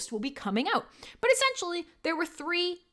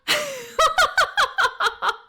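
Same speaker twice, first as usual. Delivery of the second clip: high loud laugh; 8 dB louder than usual. Real, not acted. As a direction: burst out laughing. Laughing at his joke.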